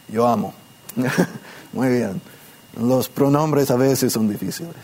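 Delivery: ordinary talk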